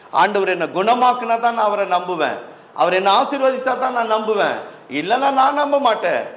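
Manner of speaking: voicing stubborn conditions, climbing in pitch; insistent doubter. Preacher imitating churchgoers who will not believe, insistent.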